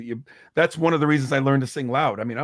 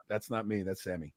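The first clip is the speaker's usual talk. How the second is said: quiet reply; 7 semitones lower, 13 dB quieter than usual. Retold or imitated. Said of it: "It's like".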